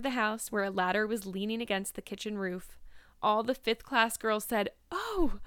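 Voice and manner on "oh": high startled "oh"; excited dismay. A narrator's reading. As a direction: gasp it high, half thrilled, half dismayed. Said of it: "partly in excitement, partly in dismay".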